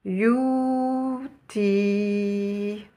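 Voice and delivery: teacher models "you" then "ti" slowly, syllable by syllable; teacher shows how the joined word is read; Arabic word read aloud. Shown as a demonstration. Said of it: "Nous obtenons".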